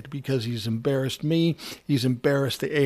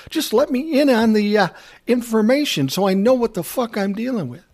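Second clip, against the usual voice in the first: higher and louder, a mild mock outburst; angry demand; 7 semitones higher, 4 dB louder than usual. A and R guy pushing the narrator for answers, with a mild mock outburst. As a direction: demand answers with a touch of anger.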